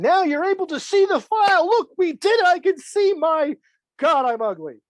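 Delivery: loud, high-pitched excited outburst, falling off at the end; excited first-time filmmaker. Acted. New filmmaker seeing their own footage, high, loud mock excitement. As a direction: act out giddy pride, then dismay.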